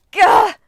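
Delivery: high, very loud cry of pain; sudden blinding scream; pained shriek. A narrator's reading. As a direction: shriek as the light hits. Goddess blinded by beam.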